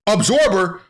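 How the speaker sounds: shrieking outrage; shocked Trunks. Retold or imitated. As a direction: react as if your dad just lost it. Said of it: "Trunks is like".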